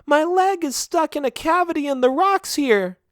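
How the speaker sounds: a small trapped sheep calling for help; stuck sheep. A narrator's reading. Trapped sheep calling to her rescuer, very high. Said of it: "she said".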